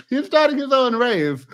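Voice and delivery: high, loud, incredulous repeat; real burst of amused incredulity; amused disbelief. Real, not acted. Repeats it after laughing, amused disbelief.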